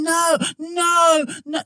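high, strained pleading no's; mock pleading carer. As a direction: beg the client to stop like a coddling carer. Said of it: "But when you're doing".

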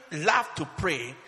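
normal talking voice

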